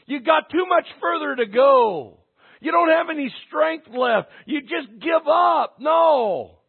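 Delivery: high, loud, driving sermon delivery; full-force preaching. Real, not acted. Preacher urging church toward the finish line, forceful preaching. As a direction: preach it out to rally the room.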